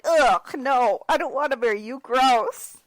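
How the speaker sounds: a hammed-up, grossed-out Bella refusing to marry; mock disgusted teen. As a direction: push the proposal away like it's gross. Bella rejecting marriage.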